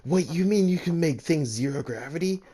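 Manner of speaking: high, eager amazement; suddenly thrilled by the idea; excited. Acted. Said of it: "getting excited and saying".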